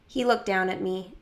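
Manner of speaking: plain reading voice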